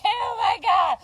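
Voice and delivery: mocking a drunk girlfriend wailing to be taken to jail; mocking imitation. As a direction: ham up the drunk crying girlfriend. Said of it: "y'all be over there like".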